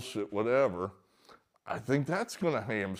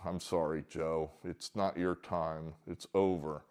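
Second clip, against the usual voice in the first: gentle consoling; 6 semitones lower, 6 dB quieter than usual. Retold or imitated. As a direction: ease the news to him softly. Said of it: "gingerly put their arm around him".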